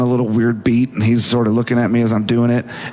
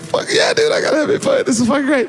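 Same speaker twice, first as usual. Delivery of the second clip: very high-pitched gushing shout; acted elation; 13 semitones higher, faster than usual. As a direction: squeal it like a thrilled fan. Acts excited reply to praise.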